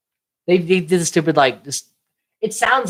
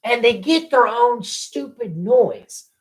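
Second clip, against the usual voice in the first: high, slow, indignant complaint; mad rant; 8 semitones higher, much slower than usual. Real, not acted. Host ranting about the cops' silly theme, mad and exasperated. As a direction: stretch it out, fed up. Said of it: "Let me be mad here".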